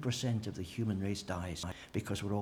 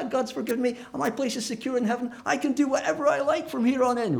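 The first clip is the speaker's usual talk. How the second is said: much higher, breezy, carefree; mock the one who thinks anything goes; smug mock believer; 12 semitones higher, 5 dB louder than usual. Preacher mocking a careless believer, high and smug. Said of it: "you can say".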